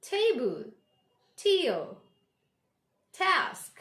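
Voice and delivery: table, tear, task with crisp puffed T; emphatic isolated words, falling; aspirated T. Shown as a demonstration. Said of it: "So the sound is between".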